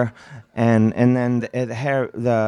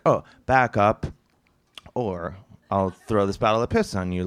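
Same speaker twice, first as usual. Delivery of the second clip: mock-casual threat with a long pause; leisurely, offhand version of a threat; time to think; 4 semitones lower than usual. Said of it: "you think that he's like".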